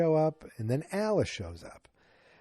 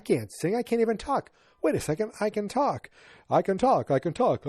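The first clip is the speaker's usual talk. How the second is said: higher, excited mom voice; Carol surprised; 9 semitones higher than usual. Retold or imitated. Carol finding she can talk again, high and excited. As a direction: realize the voice is back. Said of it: "She's like".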